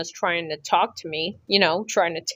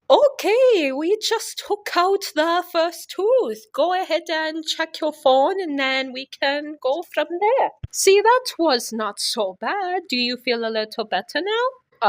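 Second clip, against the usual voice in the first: put-on Eastern European accent; 9 semitones higher than usual. Surgeon updating patient mid-extraction, high Eastern European accent. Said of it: "Miss Eastern European".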